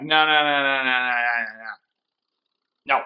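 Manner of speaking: a hint of a horrified dad trailing off; dismayed father. Crime lord dad to his son, mock dismay fading to nothing.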